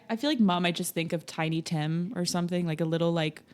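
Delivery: ordinary talk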